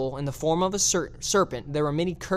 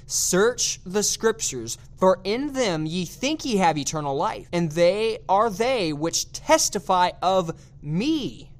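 slow, raised pitch on "Search the scriptures", each word weighted; emphatic scripture reading; 3 semitones higher, slower than usual. Shown as a demonstration. Preacher points out a key verse to listeners, emphatic. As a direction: draw out "testify of me" slowly and emphatically. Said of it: "point out and emphasize here".

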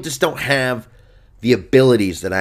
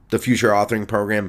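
ordinary talk

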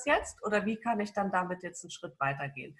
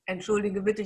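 normal talking voice

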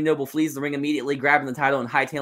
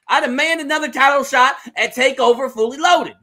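throwing a fit; 12 semitones higher, 10 dB louder than usual. Acted. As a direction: rant at full pitch, outraged and loud. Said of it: "throwing a fit about last week".

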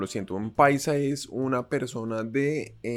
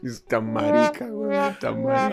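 laughing; 13 semitones higher than usual. Real, not acted. Laughing fit after fart sound.

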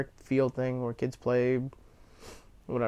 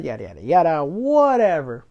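dismissive; 2 semitones higher, 6 dB louder than usual. Real, not acted. Dismissing her retold embarrassment rule.

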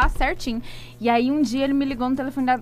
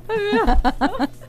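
loud, high wailing mock cry; mock sobbing; 8 dB louder than usual. Shown as a demonstration. Telling friends her teen heartbreak, demonstrates loud mock sobbing. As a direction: ham up a heartbroken wail. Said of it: "aquele choro, assim, ó".

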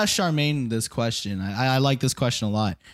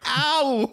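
retold ow; 11 semitones higher than usual. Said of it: "I'm like".

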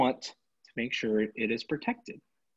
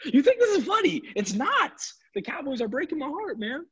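high-pitched laughing scold; high, laughing mock outrage; laughing rant; 12 semitones higher than usual. Real, not acted. Laughing, then mock-serious outburst.

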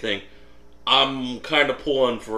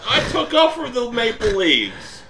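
laughing; 11 semitones higher, 6 dB louder than usual. Real, not acted. Laughing while defending himself.

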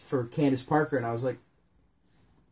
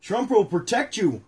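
slow read-aloud; 7 semitones higher, 4 dB louder than usual. Acted. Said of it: "reading the book aloud".

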